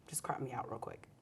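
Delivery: low, near-whispered aside; hushed aside. Real, not acted. Host asking crew to cut a flub, hushed aside. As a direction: murmur it quickly off the record, very softly.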